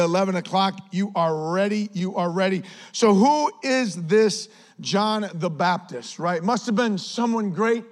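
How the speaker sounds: normal talking voice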